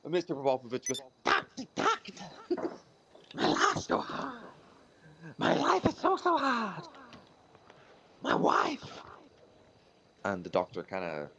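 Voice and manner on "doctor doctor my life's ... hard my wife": very high, slow, mournful moth; sad moth voice. Acted. Moth complaining to the foot doctor, very high and mournful. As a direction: wail like a heartbroken moth. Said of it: "What is that voice?".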